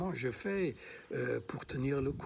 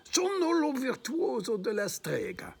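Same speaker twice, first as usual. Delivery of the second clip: high, hammed-up Italian sorcerer line; Fabrizio in Italian; 12 semitones higher than usual. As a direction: proclaim it like a showy Italian maestro. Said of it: "disait Fabrizio". (voix haute d'Italien théâtral)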